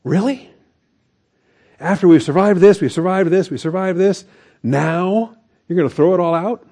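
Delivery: high, exasperated disbelief; rising incredulous voice at backsliders. Retold or imitated. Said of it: "And you think".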